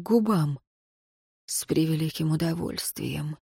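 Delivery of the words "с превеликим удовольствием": low, soft seductive murmur. A narrator's reading. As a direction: tempt her with a hushed low voice. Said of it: "искушающе произнёс Хартий".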